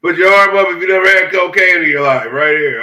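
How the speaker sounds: loud, high game-host call to the room. Pretend. Joking host shouting the prompt to the room, loud call. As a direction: belt it out like a party game host. Calls it out again louder.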